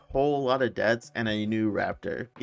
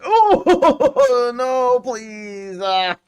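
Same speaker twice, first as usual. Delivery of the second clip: much higher laughing plea; laughing panic; 12 semitones higher, 11 dB louder, much slower than usual. Real, not acted. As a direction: beg through panicked laughter. Being chased, laughing.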